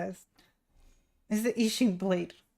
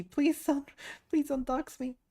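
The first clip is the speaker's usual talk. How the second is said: higher-pitched playful begging; mock pleading; 8 semitones higher than usual. Streamer begs the keyboard not to dox, mock pleading. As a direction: beg in mock alarm.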